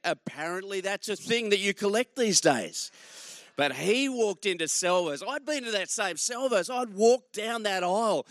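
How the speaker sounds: ordinary delivery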